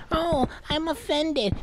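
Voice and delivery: high, soft, slow put-on wail; feebly aggrieved, in a high voice; mock offence. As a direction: imitate someone making a show of hurt. Mimics attention-seeking offended people.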